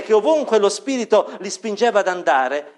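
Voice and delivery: ordinary talk, nothing marked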